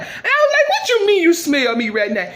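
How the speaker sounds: very high shocked squeal; high, laughing disbelief. Acted. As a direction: jump way up in shock. [name] shocked at accusation.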